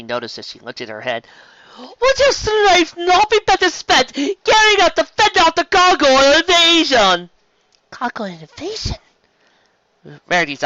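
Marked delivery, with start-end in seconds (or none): 1.8-7.3 loud, high, imperious grande dame; serpent queen. Acted. Queen rebuking the dragon for intimidation, haughty and loud. 7.9-9.0 high, rising hushed question; startled question. Acted. Pony hearing of an invasion, startled question.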